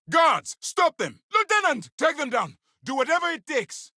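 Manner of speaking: very high-pitched, yelled commands; urgent shouted orders. Acted. Officer ordering guards to stop escapees, urgent shouting. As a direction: yell for the guards in full alarm.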